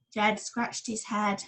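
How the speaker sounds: plain reading voice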